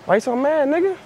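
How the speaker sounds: high, teasing question; mock squeaky taunt. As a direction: needle him in a high voice. Pranking a shopper.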